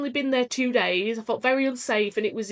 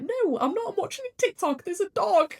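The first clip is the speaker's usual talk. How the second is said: mock tearful protest; 9 semitones higher than usual. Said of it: "I'm like".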